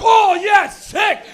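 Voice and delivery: high, very loud fan cheer; ecstatic yell. Acted. Kid watching the art show intro, ecstatic shouting. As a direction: erupt in hammed-up delight. Excited child viewer acted out.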